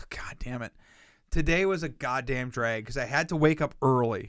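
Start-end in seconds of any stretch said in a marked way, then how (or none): 0.1-0.7 hushed whisper with a sigh; whispered curse. Real, not acted. Annoyed aside to his friend, whispered. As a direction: breathe the curse out under your breath. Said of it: "whispered aside".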